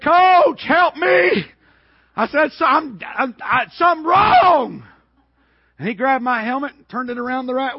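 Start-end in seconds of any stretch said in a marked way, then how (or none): 0.0-1.5 high, loud acted plea; panicked cry for help. Blinded player calling to his coach, panicked shout. 2.5-4.8 stammer, then a raised shout; acted panic. Hurt player telling his coach, stammering mock panic.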